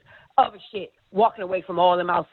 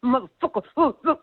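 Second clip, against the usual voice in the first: muttering to herself; 3 semitones higher than usual. Retold or imitated. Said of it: "talking to herself".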